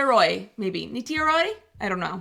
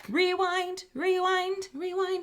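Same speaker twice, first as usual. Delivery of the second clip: high, repeated rewind call; playful high rewind, fading at the end; mock rewind; 10 semitones higher, slower than usual. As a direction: chant it like a tape rewinding.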